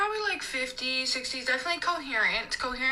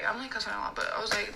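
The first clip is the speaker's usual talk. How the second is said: usual speaking voice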